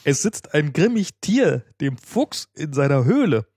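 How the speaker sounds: slow, dramatic bear's reply; troubled storybook bear. Acted. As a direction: plead slowly and theatrically to the bee. Bear's lament acted to the bee.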